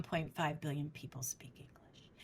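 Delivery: whisper. Real, not acted.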